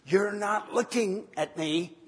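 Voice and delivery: high, sharp, almost angry. Retold or imitated. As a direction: whine it, half-angry and high. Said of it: "in a very, uh, almost angry voice".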